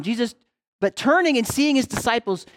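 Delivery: usual speaking voice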